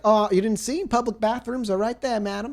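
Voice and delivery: high, put-on helpful voice; nurse Susie. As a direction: point it out up high.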